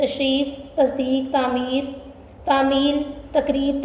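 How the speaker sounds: ordinary delivery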